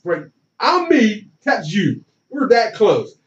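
ordinary talk

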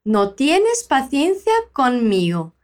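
slow, careful line for students to copy; slow teacher model. Shown as a demonstration. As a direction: say it slowly so they can repeat. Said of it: "Fala depois de mim".

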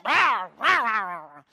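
loud "rah, rah" growl, falling away; mock snarling roar. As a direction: roar back like a grouch biting someone's head off. People snarling back when asked.

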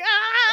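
high laughing shriek; mock scream. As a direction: shriek from the railing in mock panic.

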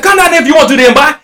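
high, loud retold comeback; shouted dare. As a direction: yell back at the caller, daring him. Retold shouting match on the phone.

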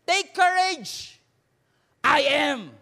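very slow, loud, high and commanding reassurance; Jesus speaking, big and unhurried. Acted. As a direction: proclaim it slowly as Jesus calming them. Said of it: "But Jesus immediately said".

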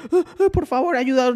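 very high-pitched, frantic begging, imitated; high pleading girls. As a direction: beg for help like a scared girl. (súplica aguda y asustada imitada)

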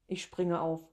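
usual speaking voice

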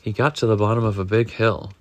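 ordinary delivery

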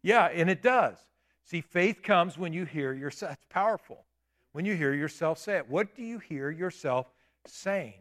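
ordinary delivery

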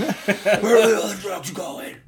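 high laughing Bat voice parody; hammy Batman growl through laughs. Acted.

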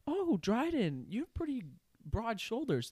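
put-on high breathy teasing girl voice; mock girly whisper.

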